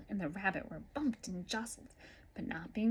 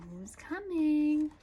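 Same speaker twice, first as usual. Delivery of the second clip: excited; 8 semitones higher, 6 dB louder, much slower than usual. Acted. Dramatic question to listener.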